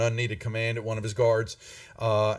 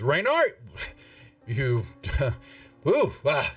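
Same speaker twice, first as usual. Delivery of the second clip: startled recoil; 2 semitones higher than usual. Acted. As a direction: jump back from a bad smell. Captain recoils at the smell.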